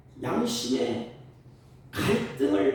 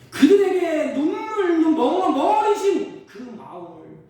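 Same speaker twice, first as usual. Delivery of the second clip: a sudden loud, high, slow cry; fervent preaching; 11 semitones higher, 10 dB louder than usual. Acted. Pastor crying out to the congregation, loud fervent preaching. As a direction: break out in fervent proclamation.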